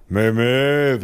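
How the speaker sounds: muffled, mushy denial; dismissive numb-mouthed father. A narrator's reading. Father denying fire to the officer, muffled dismissal. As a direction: brush it off through a numb mouth. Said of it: "winkte mein Vater ab".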